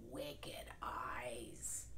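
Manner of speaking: hushed, creepy whisper; storyteller whispering the scary part; spooky whisper. A narrator's reading. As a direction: whisper it to give a shiver. Spooky wolf description.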